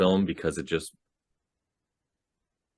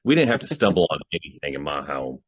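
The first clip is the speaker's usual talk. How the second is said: ordinary talk, nothing marked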